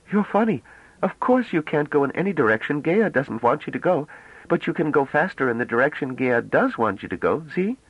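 put-on high, bright-eyed girlish voice, full of wonder; young woman Bliss, eager. A narrator's reading. Young woman marvelling at the ship, high and excited.

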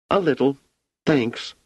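put-on high, small child voice; a girl only half consoled; a bit cheered girl. A narrator's reading. Wanda tells Hugo she feels a little better.

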